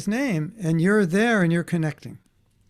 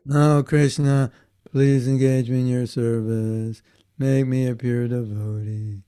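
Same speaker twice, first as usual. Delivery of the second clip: mock devotion; 5 semitones lower than usual. Shown as a demonstration. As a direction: intone an exaggeratedly devout plea. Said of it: "it doesn't sound like that".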